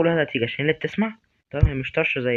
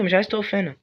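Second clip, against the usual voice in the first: normal talking voice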